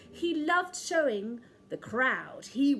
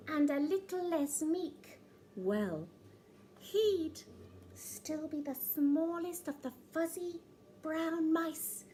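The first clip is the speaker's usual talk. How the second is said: higher little mouse voice; hopeful mouse; 4 semitones higher than usual. Acted. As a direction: dream aloud as a tiny mouse. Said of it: "he said".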